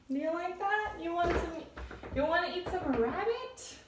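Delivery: high coaxing pet voice. Real, not acted. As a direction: coax the cat sweetly. Offering cat food.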